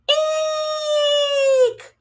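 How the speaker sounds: high, tiny frightened eek; a sudden squeal of fright; startled squeak. Acted. Small creature squeaks in fright at a surprise.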